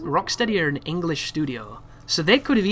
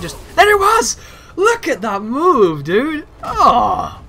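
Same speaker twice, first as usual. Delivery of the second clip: much higher and louder, thrilled shouting; excited yelling; 12 semitones higher, 8 dB louder, slower than usual. Real, not acted. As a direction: yell with delight at the move, slowing on look. Thrilled by character's move.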